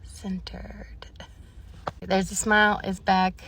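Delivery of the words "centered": breathy unvoiced whisper to herself; self-soothing whisper. Real, not acted. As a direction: whisper it to calm yourself down. Calming herself after the appointment.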